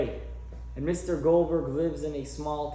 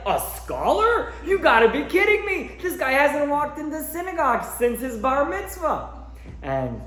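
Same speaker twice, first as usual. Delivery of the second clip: scoffing outraged heckle; 10 semitones higher, 4 dB louder than usual. Retold or imitated. Old man heckling the eulogy.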